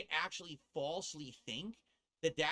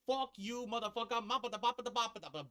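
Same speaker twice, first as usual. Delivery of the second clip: hammed-up Italian gibberish, higher and fast; mock Italian rant; 7 semitones higher than usual. Streamer pretending to shake the Olive Garden bosses, mock Italian rant. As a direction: rattle off fake Italian like a cartoon mobster. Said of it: "I would be like".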